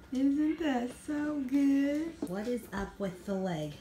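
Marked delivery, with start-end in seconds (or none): 0.1-2.1 slow, higher sing-song coaxing. Real, not acted. Mom coaxing baby to eat.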